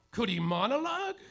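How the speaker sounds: ordinary talk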